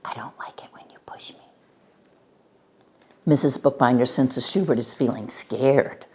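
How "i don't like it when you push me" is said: very soft, quick whisper; teeny tiny whisper. A narrator's reading. Frightened Schubert practises his line, in a tiny whisper. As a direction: whisper it timidly, barely audible. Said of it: "whispers in a teeny tiny voice".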